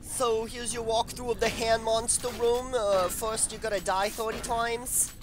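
hammed-up New Jersey wiseguy guide; New Jersey accent. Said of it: "I'm from New Jersey".

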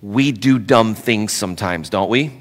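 soft, low, gentle admonishment; tactful and hushed. Real, not acted. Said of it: "wanna say this with all gentleness".

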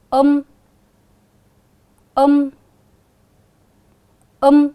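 loud, clear model of the rhyme um; drawn-out um. Shown as a demonstration. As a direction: say um loudly and clearly for the class to copy. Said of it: "Các con cùng đọc vần mới". (đọc mẫu vần um to, rõ)

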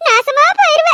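high-pitched scolding outburst; angry cursing. Acted. Woman cursing a wrongdoer, high angry scolding. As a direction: curse them in a high, indignant voice. Cursing someone in an acted quarrel.

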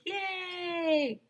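high cheer. Acted. Everybody cheering as the lights come on, high. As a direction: cheer like a whole school. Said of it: "everybody cheered".

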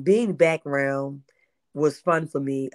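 ordinary delivery

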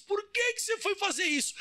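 high, strained dying plea; dying turtle. Acted. Stung turtle questioning the scorpion as it dies, high and pained. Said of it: "ela foi morrendo assim".

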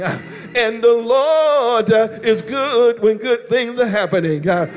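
high, sung, rhythmic whoop over the organ; whooping preacher. Acted. Pastor whooping to his church, high chanted build. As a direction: preach it home, chanting each line up high.

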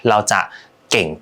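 normal talking voice

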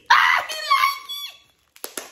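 very high, slow, drawn-out voice for the pet. Pretend. Voicing her dog, who likes the purse, squeaky and slow.

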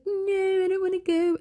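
high, whiny protest; reluctant woman voice. Retold or imitated. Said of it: "and she's like".

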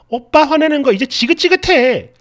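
mock hysterical shout; imitated frantic yelling. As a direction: act out a wife yelling, peaking on the last word. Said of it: "거의 뭐 발광을 하고 소리를 질러요".

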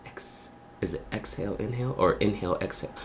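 breathy hush, barely voiced, calming down from laughter; near-silent breathing count. Real, not acted. Streamer composing himself after laughing, near-silent whisper. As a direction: whisper it to yourself to stop laughing. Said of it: "I'm trying to compose myself right now".

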